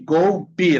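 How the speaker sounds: Golpe as "GOU-pi", loud; final E as I. Shown as a demonstration. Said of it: "se pronuncia como I".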